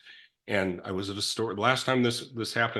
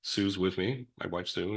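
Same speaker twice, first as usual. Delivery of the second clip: ordinary delivery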